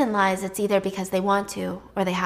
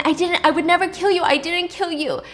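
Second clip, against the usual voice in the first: higher, louder frantic pleading; desperate denial; 8 semitones higher, 4 dB louder than usual. Retold or imitated. Anna in the coffin pleading with David, frantic and high. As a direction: beg him to believe you're innocent. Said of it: "she's trying to explain".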